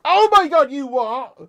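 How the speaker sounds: high, loud outburst of disbelief; shocked disbelief. Real, not acted. Streamer reacting to a claim about Ole buying Bruno, incredulous. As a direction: explode upward in incredulous surprise.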